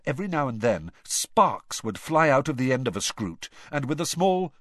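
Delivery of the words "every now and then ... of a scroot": ordinary delivery, nothing marked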